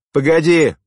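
sharp, high, loud bark; abrupt shout to stop him; barked call. A narrator's reading. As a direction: bark it after someone leaving. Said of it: "рявкнула сзади Ирина".

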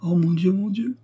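high plaintive moan.

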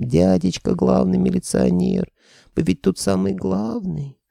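put-on high, slow squeaky little-girl whine; squeaky whining girl. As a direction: whine like a pitiful child. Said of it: "завыла писклявым голосом". (писклявым голосом)

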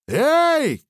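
a loud high shout to get attention. Acted. Narrator hailing the shooters with a loud shout. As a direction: call out hard over the gunfire. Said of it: "крикнул я".

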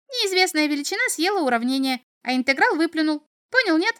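high, piping nonsense chatter; cheerful little devil. A narrator's reading. Little devil talks nonsense to the boy, cheerful. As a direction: rattle off nonsense merrily, squeakier at the end. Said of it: "весело заключил чертёнок".

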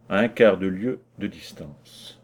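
usual speaking voice

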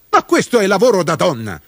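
fast, high-pitched indignant protest; irate. A narrator's reading. Mudube protests at being given women's work, irate. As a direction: burst out angrily, quick and high. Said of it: "fece con ira Mudube".

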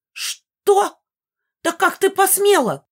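very high, loud shriek of indignation; high-pitched old ghost screeching in outrage; outraged squeal. A narrator's reading. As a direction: squeal it out, scandalized. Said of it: "завизжала Мегара".

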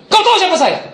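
loud, high challenge; retold intimidating bark. Pastor intimidating the thug, retold angry challenge. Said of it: "Langsung saya bentak".

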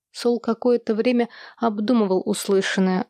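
usual speaking voice

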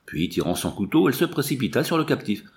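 plain reading voice

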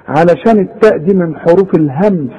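ordinary delivery

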